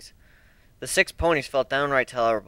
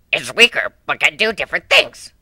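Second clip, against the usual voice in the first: rough dog voice; 2 semitones higher, 6 dB louder than usual. A narrator's reading. Etch explains.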